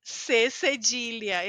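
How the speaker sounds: C cedilha said slowly and clearly; slow repeat. Shown as a demonstration. Teacher repeating the letter name slowly for students.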